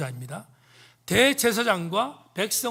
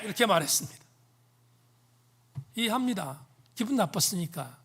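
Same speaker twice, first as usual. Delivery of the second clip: ordinary talk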